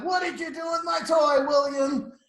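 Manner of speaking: high, loud demand held all through; hammed-up cross big sister; accusing shout. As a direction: call out a cross, drawn-up question. Same accusation to baby brother.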